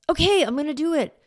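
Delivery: put-on a child jumping to obey; eager kid. Child complying, voiced by mom.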